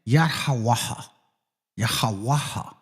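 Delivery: "Yad Ha Waha" in open syllables, then run together; "Yad Ha Waha" with an airy final ha; demonstrated pronunciation. Shown as a demonstration. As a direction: model "Yad Ha Waha", then join it as "Yahawaha". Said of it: "You see what I'm saying?".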